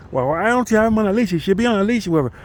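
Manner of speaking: put-on high, fast scolding old-lady voice; mimicking old lady. The lady repeating her leash complaint, high and fast. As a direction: pitch up and rattle it off like a nag. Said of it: "she's like".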